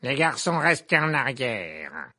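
loud command, slowing on the last words; barked order. A narrator's reading. Witch professor holding the boys back, barked. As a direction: bark it, drawing out the warning. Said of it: "aboya le professeur Gobplanche".

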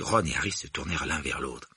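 ordinary delivery

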